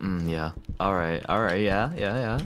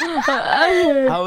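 laughing through it; cracking up mid-word; 7 semitones higher, 3 dB louder than usual. Real, not acted. Spat water on himself.

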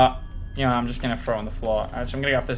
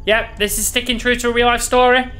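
high-pitched, agitated aftershock; rattled and terrified; 9 semitones higher, 4 dB louder than usual. Real, not acted. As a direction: let the scare push your voice up. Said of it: "I'm terrified".

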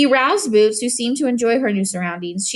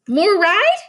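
higher, louder little plea; a toddler begging for more; pleading toddler; 9 semitones higher, 5 dB louder than usual. Acted. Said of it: "pleaded Boots".

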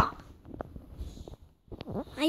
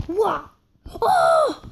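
high loud gasp of relief; surprised shout; 7 semitones higher, 5 dB louder than usual. Real, not acted. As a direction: yelp at a near miss. Narrow escape.

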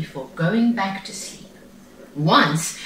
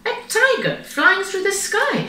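amazed mother; 14 semitones higher than usual. A narrator's reading. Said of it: "she said".

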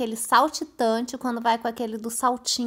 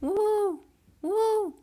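high, softer celebratory hoots; gleeful whooping; 9 semitones higher, 9 dB quieter, slower than usual. Real, not acted. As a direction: repeat little high whoops of triumph. Celebrating during the game.

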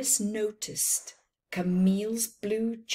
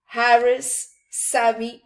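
ordinary delivery

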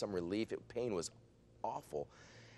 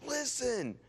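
high, loud thrilled call to everyone; excited announcement; 4 dB louder than usual. Retold or imitated. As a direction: tell the whole room in amazement. Announces healing to the group.

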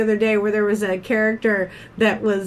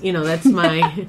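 usual speaking voice

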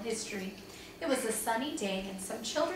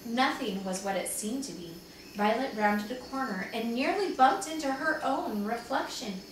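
plain reading voice

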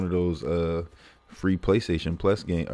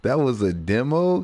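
higher, slow, shocked question; can't believe it was only a demo; disbelieving, drawn-out what; stunned disbelief; 4 semitones higher, 4 dB louder, much slower than usual. Retold or imitated. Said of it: "I was like".

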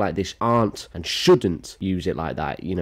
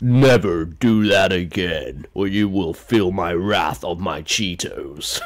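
slow, menacing villain impression; Thanos; 3 dB louder, slower than usual. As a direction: deliver a grand, drawn-out threat. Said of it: "sounds like Thanos".

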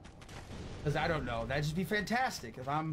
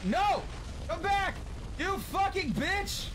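frustrated yelling; 12 semitones higher, slower than usual. Real, not acted. Losing a fight in the game.

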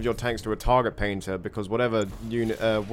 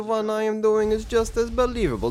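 fake Russian accent; 10 semitones higher than usual. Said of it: "perfecting this Russian accent".

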